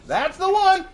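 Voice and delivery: very high cartoon trickster; Bugs Bunny. Rabbit asking about the rock in the middle, very high cartoon voice. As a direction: press the villain in a squeaky-high cartoon voice. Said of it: "Bugs walks down".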